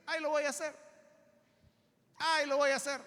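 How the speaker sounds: high, sing-song excuse; mock procrastinator's brush-off; putting it off. Preacher mimicking believers who postpone things, high brush-off.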